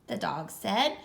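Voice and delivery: ordinary delivery, nothing marked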